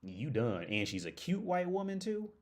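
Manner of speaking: ordinary delivery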